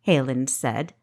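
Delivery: ordinary delivery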